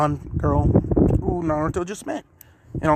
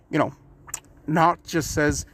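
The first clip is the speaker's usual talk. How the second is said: usual speaking voice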